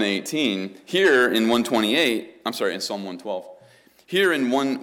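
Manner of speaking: loud, driving pulpit voice; booming sermon delivery; forceful preaching. Real, not acted.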